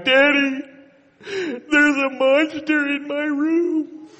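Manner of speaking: put-on high, slow whiny little boy voice; crying toddler. Father voicing his crying son about the monster. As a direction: wail it like a scared two-year-old.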